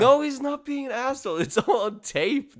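high-pitched real objection; indignant protest. Real, not acted. Podcaster defending the child to co-host, high indignant protest.

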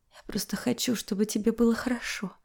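soft timid whisper. A narrator's reading. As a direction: breathe it, barely voiced. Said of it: "шептала я".

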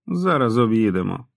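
usual speaking voice

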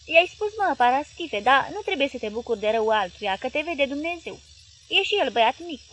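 high, loud scolding wife; coaxing wife. Acted. Wife calming her husband, high and loud. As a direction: chide him loudly but kindly.

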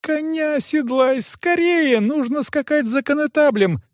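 high-pitched, pressing demand; a desperate girl urging haste; urgent girl. A narrator's reading. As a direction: plead high and insistent, without shouting. Girl urgently begs the groom.

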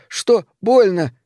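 high, pained cry. A narrator's reading. Narrator voicing boys bitten by rattlesnakes, crying out. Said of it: "Мальчики кричат".

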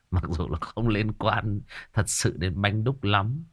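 ordinary talk, nothing marked

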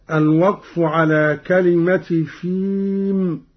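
normal talking voice

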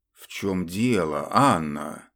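irritated, grumbling question; displeased. Acted. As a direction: ask it with annoyance. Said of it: "недовольно откликнулся".

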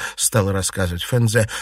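plain reading voice

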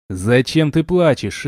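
usual speaking voice

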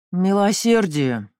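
slow, loud scornful growl. Acted. Uncle growling back at the cousin's gentle quote. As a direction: bite off each word in scorn. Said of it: "прорычал дядя Бенджамин".

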